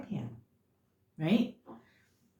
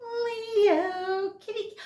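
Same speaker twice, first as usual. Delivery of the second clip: much higher cooing call to a kitty; calling the cat; much slower than usual. Real, not acted. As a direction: coo to your cat across the room.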